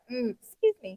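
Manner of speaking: soft, held-in pretend burp, then hushed apology; discreet burp apology. Showing co-host how you hold a burp in, discreet and soft. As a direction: demonstrate a polite little excuse me, softly. Demonstrating holding a burp in politely.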